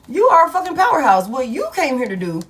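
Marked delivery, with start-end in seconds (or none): 0.1-1.3 louder and faster, punching "fucking powerhouse"; emphatic hype. Real, not acted. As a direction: hype them up, hit "You are a fucking powerhouse" hard and quick. Repeats louder with added curse.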